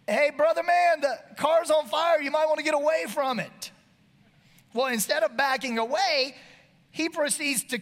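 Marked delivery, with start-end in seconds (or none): 0.1-3.7 loud call across the road; hey there, loud and urgent; yell. Retold or imitated. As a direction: shout a warning from a distance. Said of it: "finally I yell".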